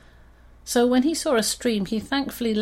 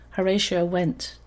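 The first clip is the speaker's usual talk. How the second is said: plain reading voice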